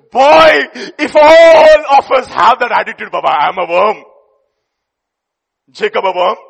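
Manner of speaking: loud, impassioned preacher cry; fervent shout. Retold or imitated. Preacher retelling his lesson, shouting with passion. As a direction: shout it with conviction. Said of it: "I said".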